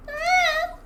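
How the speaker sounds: sudden high yelp of surprise; a sharp startled squeal; a high, loud little shriek. Real, not acted. Startled by flashing screen.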